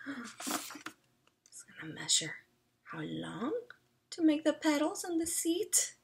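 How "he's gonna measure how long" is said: hushed excited whisper. Real, not acted. Reader whispering to the listening child about the measurements. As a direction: whisper it, wide-eyed.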